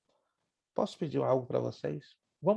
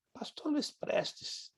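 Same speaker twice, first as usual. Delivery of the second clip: quoting the pastor; 11 semitones higher, 4 dB quieter, much slower than usual. Retold or imitated. Pastor announces his name.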